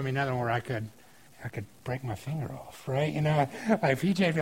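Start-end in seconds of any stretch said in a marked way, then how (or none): none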